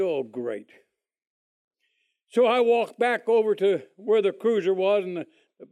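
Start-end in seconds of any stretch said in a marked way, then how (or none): 0.0-0.7 much lower and softer, deadpan defeat; dejected groan. Retold or imitated. Crashed drunk on probation, muttering to himself, low and defeated. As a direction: sink into it, knowing you're done.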